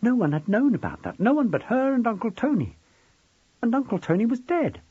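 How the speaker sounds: high, distressed narration; rising panic. A narrator's reading. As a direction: pitch climbing with a touch of panic. Narrated panic, wanting to scream.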